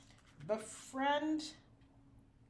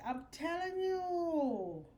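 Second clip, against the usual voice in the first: insisting, drawn out; drawn-out emphasis; 6 semitones higher, 4 dB louder, much slower than usual. Real, not acted. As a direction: stretch out "I'm telling you" slowly and higher. Emphatic assurance to viewers.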